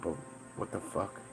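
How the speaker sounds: ordinary talk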